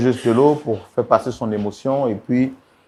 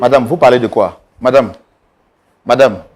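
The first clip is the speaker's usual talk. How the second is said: louder, insistent call for attention; firm interruption; 6 dB louder than usual. Acted. Mediator calling the wife to explain, loud and insistent. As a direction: cut in firmly over the quarrel.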